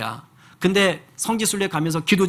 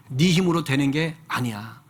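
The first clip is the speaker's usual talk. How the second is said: ordinary delivery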